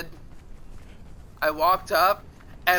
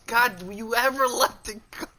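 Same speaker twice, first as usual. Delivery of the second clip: higher-pitched mock exasperation; exasperated; 4 semitones higher than usual. Coworker fed up with old grudge, exasperated retort. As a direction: imitate someone fed up with you. Retelling exasperated woman's reply.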